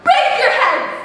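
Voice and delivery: high, ringing rallying cry; rallying shout. Acted. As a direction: call the people to rise. Rallying call.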